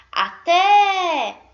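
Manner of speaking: loud, high-pitched call, final vowel held long. Shown as a demonstration. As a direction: holler it across the street, stretching the end. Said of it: "agora eu vou chamar a palavrinha".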